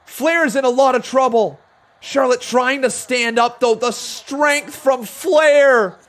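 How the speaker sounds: lively ringside match call; live play-by-play. Acted. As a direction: call the action as it happens.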